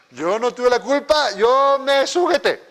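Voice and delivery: high, loud, drawn-out mock protest; self-excusing protest. Wife imagined disclaiming blame before God, mock protest. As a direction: stretch it out as an exaggerated self-defense.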